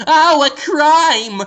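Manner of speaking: high, loud, drawn-out mock cry; hammed-up secretary spotting a crime; mock secretary alarm. Jonathan in a dress and red wig, mock alarm. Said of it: "in a dress with a red wig going".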